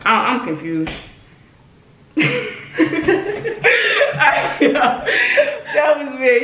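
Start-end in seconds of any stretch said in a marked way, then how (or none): 2.1-4.6 airy laugh, pitched up; breathless laugh. Real, not acted. Friend laughing after the confused call, airy laugh.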